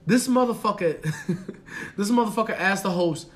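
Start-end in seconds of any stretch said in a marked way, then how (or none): none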